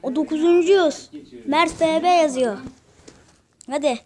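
loud frantic play-by-play; panicked excitement. Real, not acted. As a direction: shout it like you're about to lose everything. Panicking while being chased.

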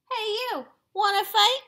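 high, loud shouted dare; shouted challenge. Acted. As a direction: yell it up at the giant. Ladybug challenging the whale.

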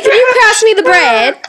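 put-on muddled British-country accent at the dinner table. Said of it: "such a country British accent".

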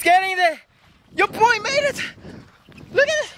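high, bright victory call; triumphant excitement. Real, not acted. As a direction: celebrate making it across. Reaching the end of bridge.

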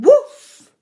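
loud barking woof. A narrator's reading. Reader voicing Nell's bark, loud woof. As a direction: shout the woof like a dog. Dog barks again in story.

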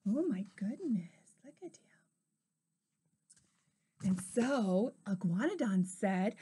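ordinary delivery

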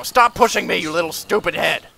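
loud frustrated shout at a mob; angry yell. Real, not acted. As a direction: yell it in annoyance. Mob pushes player, yells at it.

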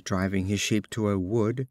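ordinary delivery, nothing marked

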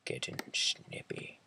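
unvoiced mocking whisper; teasing whisper. Real, not acted. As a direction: whisper it as a sly tease. Teasing friend.

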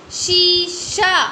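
शीशा with both long ई and आ drawn out; teacher stretching शीशा for the class; stretched reading. Shown as a demonstration. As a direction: pull out शीशा slowly, vowel by vowel. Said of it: "क्या हो गया ये?".

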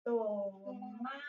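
soft, stretched syllables in TOMATE; drawn-out dictation. Shown as a demonstration. Teacher dictating tomato in Spanish as she writes, drawn out. Sounds out word while writing it.

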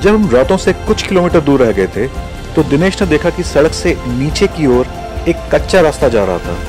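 ordinary talk, nothing marked

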